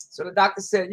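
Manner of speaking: ordinary talk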